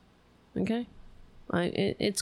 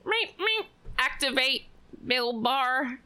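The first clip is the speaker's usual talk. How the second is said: high robotic beeps, then a raised machine voice; mock fembot; 6 semitones higher than usual. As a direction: mimic a robot powering up. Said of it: "what is he, a fucking fembot".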